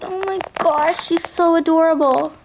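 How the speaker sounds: ordinary delivery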